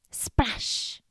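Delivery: splash effect. A narrator's reading. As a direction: exclaim it like a sudden plunge. Story sound effect word.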